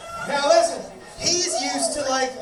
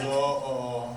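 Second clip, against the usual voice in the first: low, softer stoned murmur, imitated; lightly voiced stoner; 9 semitones lower, 6 dB quieter than usual. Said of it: "when you're that high".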